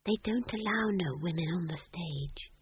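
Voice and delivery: soft, timid confession; meek, hushed reply; small voice. A narrator's reading. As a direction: answer quietly, bracing for trouble. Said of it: "in a small voice".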